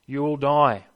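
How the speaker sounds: ordinary delivery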